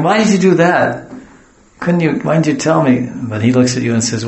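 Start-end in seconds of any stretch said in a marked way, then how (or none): 0.0-3.1 high, loud questioning of a dog; hammed-up owner's reprimand; mock exasperated scolding. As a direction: demand an answer from the dog, pitched up.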